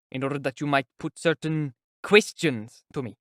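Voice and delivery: pointed emphasis on "questions"; stress on questions. A narrator's reading. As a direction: make "questions" stand out pointedly. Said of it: "He placed special emphasis on the word questions".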